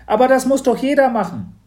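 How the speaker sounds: high, loud and quick; a little girl insisting; high, quick girl's voice. Acted. Mathilda insists. (hohe, schnelle Mädchenstimme)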